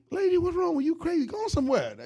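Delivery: Joseph's voice jumps high, loud and offended; indignant outburst. Retold or imitated. Joseph yells at Potiphar's wife to leave him alone, high and loud. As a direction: push her off in loud disbelief. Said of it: "and he like".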